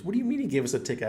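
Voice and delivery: high, fast, loud disbelief; wife surprised. Retold or imitated. Wife hearing of the ticket, fast and high. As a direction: fire off the question in shock. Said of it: "she goes".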